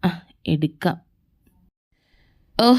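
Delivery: usual speaking voice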